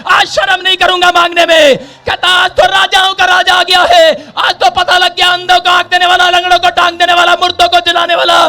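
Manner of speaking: driving, shouted proclamation; loud shouting. Acted. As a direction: cry it out in an unbroken rush of praise. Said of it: "और जोर जोर से चिल्लाने लगा". (ज़ोर से चिल्लाकर घोषणा)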